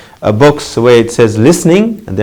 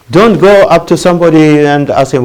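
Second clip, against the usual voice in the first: ordinary delivery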